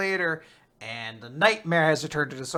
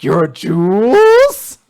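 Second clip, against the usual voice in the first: slow, much louder, menacing drawn-out question; terrifying menace; 3 semitones higher, 13 dB louder, much slower than usual. Retold or imitated. Father confronting the bullies' taunt, slow menacing. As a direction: loom over her with it. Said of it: "the most terrifying expression ever".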